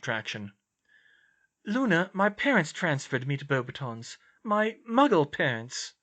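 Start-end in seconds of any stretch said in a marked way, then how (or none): 1.6-5.9 higher-pitched character voice, earnest; raised, sincere dialogue voice; earnest young heroine. Acted. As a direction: voice the concerned friend, pitched up. Hermione corrects Luna.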